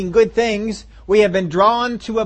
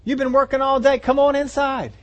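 higher, coaxing plea to come in; pleading father; 8 semitones higher than usual. Retold or imitated. Father pleading with his older son, a touch higher. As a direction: coax him inside gently. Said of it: "pleaded with him".

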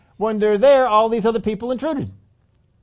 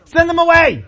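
bossy demand; 10 semitones higher, 9 dB louder than usual. Retold or imitated. Disciples ordering Jesus to send the crowd off, loud bossy demand. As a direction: tell Jesus to get rid of them. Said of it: "they come up to him and say".

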